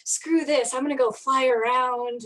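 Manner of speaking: excited retelling. Retold or imitated. Retells her excited thought on realizing.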